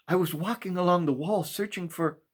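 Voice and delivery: put-on high eager young boy; boy's voice. A narrator's reading. Boy explaining to the maker, high eager young voice.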